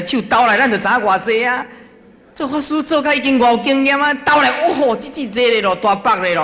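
loud, high-pitched boasting; animated comic bragging; joking excitement. Retold or imitated. Jokes about weighing an offering by hand.

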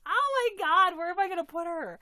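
loud high fast gush; excited outburst. Real, not acted. Wondering where to display the doll, excited loud gush. Overwhelmed by the gift.

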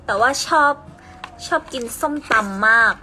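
loud, teasing Isan dialect; ภาษาอีสานแบบหยอกล้อ เสียงดัง. Pretend. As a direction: tease the viewers in Isan speech. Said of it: "พูดภาษาอีสานเหรอ".

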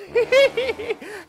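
high-pitched giggling laugh; gleeful cackle. Acted. Man gloating over his purchase, high gleeful laugh.

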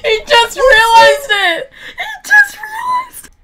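high, loud shocked yelling; excited shout. Real, not acted. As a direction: yell it out in disbelief. Shocked that seeker spots hider.

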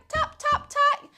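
high, light, separate taps; magic taps. A narrator's reading. Witch taps broomstick before take-off, high crisp beats. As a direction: pip each word out high.

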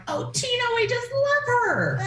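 high, gushing imitation; gushing high quote. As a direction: squeal it like a fan. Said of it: "they're like".

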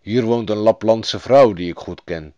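lightly voiced animal guide; a hint of a reindeer character; talking reindeer. A narrator's reading. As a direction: explain as a storybook reindeer. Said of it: "zei hij".

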